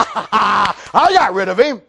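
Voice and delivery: slow, laughing relief; gloating laugh. Acted. Pilate pleased to be rid of Jesus, gloating laugh. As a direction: laugh it off, stretching the words.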